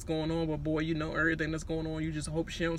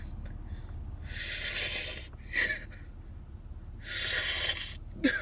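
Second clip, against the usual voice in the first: high laughing fit; 8 dB quieter than usual. Real, not acted. Cracking up at Jackson sipping.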